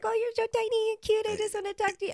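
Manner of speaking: put-on high cooing voice to a baby; adult cooing at a baby; baby talk. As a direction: gush at something tiny and cute. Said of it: "you're like".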